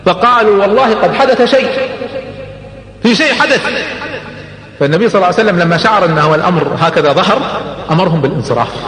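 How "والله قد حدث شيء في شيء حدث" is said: high, startled exclamation; alarmed. Retold or imitated. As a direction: sound taken aback. Meccans react in alarm.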